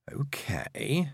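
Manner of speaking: drawn-out, tentative okay; hesitant, wary inner voice; careful thought. A narrator's reading. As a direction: think it through cautiously. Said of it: "she thought carefully".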